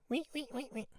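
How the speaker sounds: tiny high squeak of a mouse in a wheel; squeaky mouse. Acted. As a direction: squeak like a little mouse running round. Mouse running in a little wheel.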